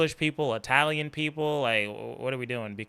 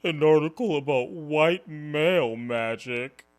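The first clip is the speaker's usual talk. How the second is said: high, slow whiny mock voice, fake-sad; mock whining; 3 semitones higher, 5 dB louder, slower than usual. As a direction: whine it like a sulking victim.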